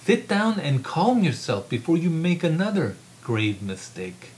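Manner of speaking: stern command. Acted. Professor sternly ordering his colleague to calm down, firm command. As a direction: order her to sit down. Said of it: "Otto's serious, forceful words".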